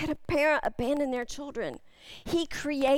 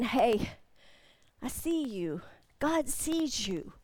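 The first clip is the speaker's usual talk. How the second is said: softer and lower, slow ending; tender reassurance; 3 semitones lower, 5 dB quieter, slower than usual. Acted. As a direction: speak gently to someone hiding. Gentle word into dark place.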